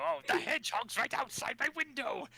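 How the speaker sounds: high, cartoonish villain squeal; panicked cartoon villain shriek; Robotnik voice. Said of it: "whenever Robotnik says".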